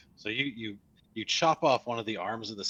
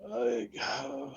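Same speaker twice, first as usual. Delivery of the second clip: skeleton voice. Acted. Skeleton voice continues.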